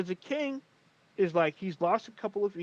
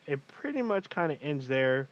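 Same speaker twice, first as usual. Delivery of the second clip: normal talking voice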